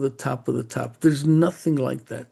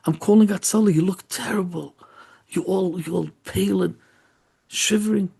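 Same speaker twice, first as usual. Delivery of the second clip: raised, urgent worried voice; acted alarm, pitched up; 5 semitones higher, 3 dB louder than usual. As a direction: react as if it's an emergency. Friend alarmed at boy's shivering.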